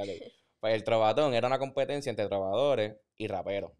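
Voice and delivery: ordinary talk, nothing marked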